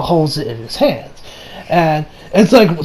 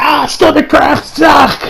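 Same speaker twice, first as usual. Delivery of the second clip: high, drawn-out mock cry of pain; acted pain cry; 6 semitones higher, slower than usual. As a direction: groan out a hammed-up stomach cramp.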